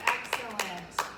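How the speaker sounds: ordinary delivery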